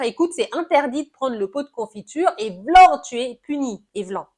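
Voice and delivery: a loud, stern mock scolding with a slam on vlan; strict parent scolding. Parent scolding child over the jam pot, mock stern and loud. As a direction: play the harsh parent cutting the child off. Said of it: "au lieu juste de dire".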